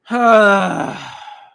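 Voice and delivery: big, long forceful exhale; overwhelmed loud sigh. Real, not acted. Fan overwhelmed by the scene, heaving a huge sigh. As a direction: heave out a loud, high sigh.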